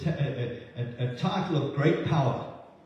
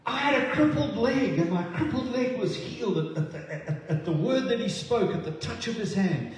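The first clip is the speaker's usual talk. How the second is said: higher, eager voice of a man whose leg was healed; excited healed witness; 7 semitones higher than usual. Retold or imitated. Preacher voicing a healed man telling the crowd, higher and eager. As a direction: bubble over with wonder at the healing. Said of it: "they would have heard someone say".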